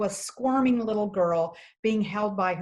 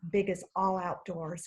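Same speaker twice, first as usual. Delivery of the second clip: ordinary talk, nothing marked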